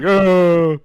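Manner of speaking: high, loud startled oh; surprised. Retold or imitated. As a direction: act caught off guard. Caught out by customer's insult.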